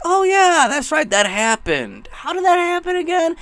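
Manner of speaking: high-pitched dopey remembering act; mock sudden recall. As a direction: act out a vague memory suddenly dawning. Mimicking people vaguely remembering.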